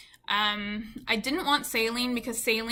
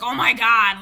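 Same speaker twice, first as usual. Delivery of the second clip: loud mock admirer's exclamation; imitated gushing amazement; 11 dB louder than usual. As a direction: burst out loudly like an impressed admirer. Imagined gushing admirer of her lips.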